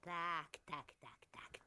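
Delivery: breathy conspiratorial whisper. Acted. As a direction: hush it, sly and secret. Villainess sneaks up whispering.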